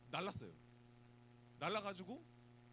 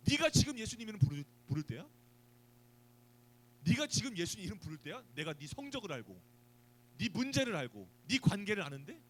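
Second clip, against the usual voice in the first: louder, higher accusing rebuke; mock scolding; 4 semitones higher, 8 dB louder than usual. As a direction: sneer at the believer's faith, lightly scolding. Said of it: "라고 나를 꾸짖는단 말이에요".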